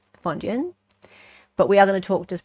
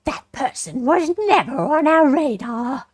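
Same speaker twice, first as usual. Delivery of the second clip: slow, higher put-on prosecutor voice; generic prosecutor's voice; 5 semitones higher, 3 dB louder, slower than usual. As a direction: deliver it like a prosecutor. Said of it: "generic prosecutor's voice".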